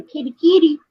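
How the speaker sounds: calling the cat. Retold or imitated. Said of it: "you scream".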